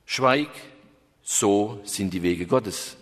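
low, slow solemn rebuke; stern companion. Acted. Companion rebukes the hermit, low and slow. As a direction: hush him with solemn, slow authority.

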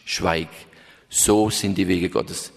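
low, slow solemn rebuke; stern companion. Acted. Companion rebukes the hermit, low and slow. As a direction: hush him with solemn, slow authority.

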